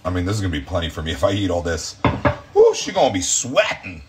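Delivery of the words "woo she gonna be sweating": high, loud playful woo; excited whoop. Real, not acted. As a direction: hype up the spice. Reacting to spicy dish.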